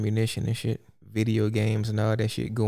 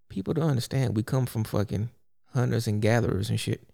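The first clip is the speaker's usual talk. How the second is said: usual speaking voice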